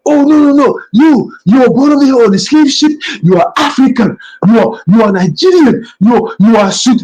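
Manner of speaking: high mocking voice of a protesting African. Preacher mocking Africans on social media, high and whiny. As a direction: mimic them crying out in protest. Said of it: "I hear all these Africans".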